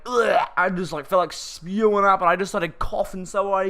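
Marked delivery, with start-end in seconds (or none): 0.1-0.5 disgusted groan. Retold or imitated. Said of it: "I was like".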